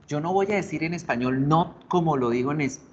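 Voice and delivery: normal talking voice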